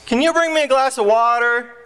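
high, called-out request; son yelling out. Acted. Son asking dad for water, yelling. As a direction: call from bed to another room. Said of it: "the son yells out".

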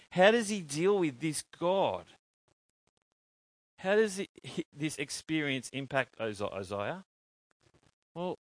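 ordinary talk, nothing marked

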